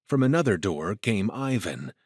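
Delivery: ordinary delivery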